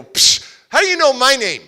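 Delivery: put-on angry kid. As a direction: snap back like a suspicious boy. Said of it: "I'm, like, frustrated, angry".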